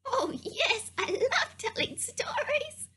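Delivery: high-pitched, eager sheep puppet voice; Barbara. Pretend. Sheep puppet agreeing to help storyteller, high eager voice. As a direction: answer keenly in a tiny high voice. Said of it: "Oh, Barbara, I'm so glad you do".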